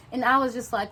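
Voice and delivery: ordinary delivery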